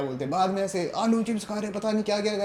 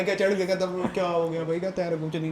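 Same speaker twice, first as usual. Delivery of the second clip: ordinary delivery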